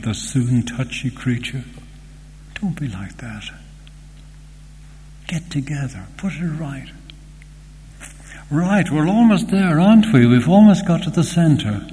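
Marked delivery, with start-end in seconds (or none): 2.6-6.9 hushed pleading whisper; whispered plea. Real, not acted. Pastoral plea to quarrelling members. 8.5-11.9 high, loud, eager announcement; loud, excited build-up; rising excitement. Real, not acted. Building excitement to sermon climax.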